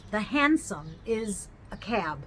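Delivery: usual speaking voice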